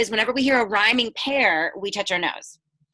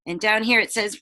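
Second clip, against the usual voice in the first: usual speaking voice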